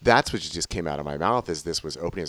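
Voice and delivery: normal talking voice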